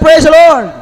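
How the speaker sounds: loud cue for the church to repeat; call to respond. Shown as a demonstration. Preacher cues everyone to answer, loud call. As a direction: lead the crowd's response. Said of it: "Everybody say".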